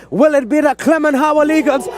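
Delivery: ordinary talk, nothing marked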